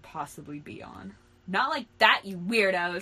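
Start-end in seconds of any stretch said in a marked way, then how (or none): 1.5-3.0 scream. Acted. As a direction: yell it in protest, punching the start. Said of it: "screamed Kyrie".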